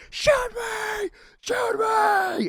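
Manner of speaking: crazed, high-pitched imitated screaming; yelling. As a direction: scream the dare like a madman. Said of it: "he just starts yelling out".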